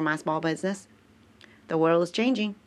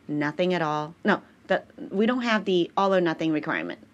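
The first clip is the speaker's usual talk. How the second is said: normal talking voice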